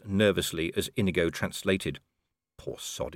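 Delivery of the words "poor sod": unvoiced whispered thought. A narrator's reading. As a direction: breathe it under the breath. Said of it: "he thought".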